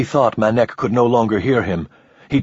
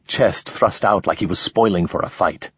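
plain reading voice

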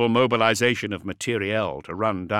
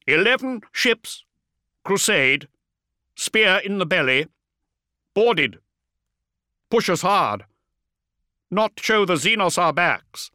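louder, higher disdainful marine; terse battle report full of disdain; gruff space marine; 10 semitones higher, 5 dB louder than usual. A narrator's reading. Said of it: "uncomplicated disdain for the alien".

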